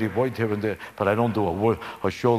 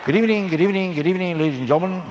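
Ed Sullivan impression; 4 semitones higher, faster than usual. Ed Sullivan greeting the audience, mock stiff host. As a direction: stand stiff and intro like Ed Sullivan. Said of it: "words of Ed Sullivan".

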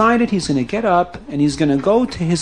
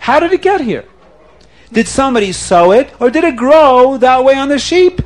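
high, louder puzzled questions; excited wonder; 7 semitones higher, 4 dB louder than usual. Acted. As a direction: ask in rising, excited amazement. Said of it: "he got so excited".

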